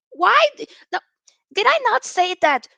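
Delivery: protest, voice shooting up; indignant outburst. Real, not acted. Indignant reply to chat.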